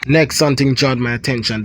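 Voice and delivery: ordinary delivery, nothing marked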